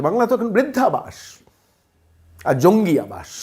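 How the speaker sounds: ordinary talk, nothing marked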